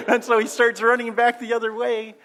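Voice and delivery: laughing through the words, high and loud; can barely get it out for laughing; amused laughing retelling. Real, not acted. Laughing while telling funny memory.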